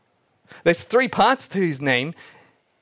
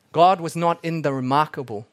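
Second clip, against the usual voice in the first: ordinary delivery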